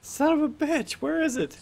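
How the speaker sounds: very high squeaky exasperation; comic frustration. Pretend. Game master hunting a lost page, in high comic frustration.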